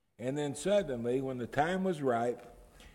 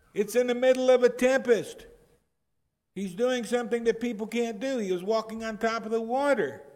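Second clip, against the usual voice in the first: high, loud pleading defense of Peter; impassioned preaching; 7 semitones higher, 6 dB louder than usual. Real, not acted. Preacher to his congregation, defending Peter at full force. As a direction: argue for Peter, voice climbing.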